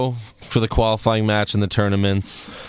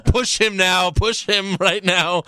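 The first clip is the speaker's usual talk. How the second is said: retold urging; 6 semitones higher, slower than usual. Retold urgent reaction while laughing.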